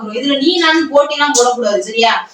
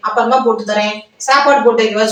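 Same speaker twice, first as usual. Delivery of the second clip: ordinary delivery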